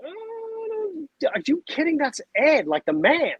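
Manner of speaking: high, amazed gasp at seeing the name; starstruck disbelief. Retold or imitated. Reading Ed's email, high starstruck disbelief. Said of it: "and I go".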